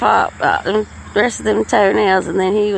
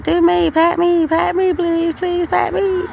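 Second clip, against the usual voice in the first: much higher pleading voice for the dog; begging dog voice; 9 semitones higher than usual. Retold or imitated. Voicing her jealous dogs begging Kenny for pats, high and pleading. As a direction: beg to be petted like a needy little dog. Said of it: "It's like".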